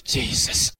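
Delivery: usual speaking voice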